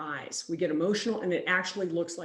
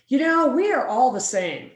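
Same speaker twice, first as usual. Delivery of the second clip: louder, higher kumbaya-style platitude; mock platitude; 4 semitones higher, 8 dB louder than usual. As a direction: parrot a well-meaning cliché loudly. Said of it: "a lot of people like to say".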